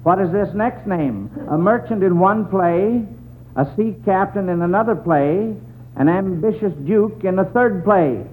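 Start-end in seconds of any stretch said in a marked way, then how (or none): none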